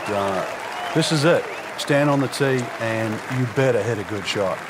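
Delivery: usual speaking voice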